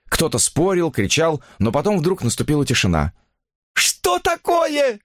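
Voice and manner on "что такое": very high, drawn-out call; frightened woman calling out; alarmed cry. A narrator's reading. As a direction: cry out in alarm, stretching the words. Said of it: "закричала она".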